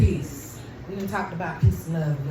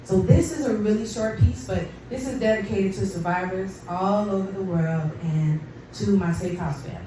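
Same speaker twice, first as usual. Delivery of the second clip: ordinary talk, nothing marked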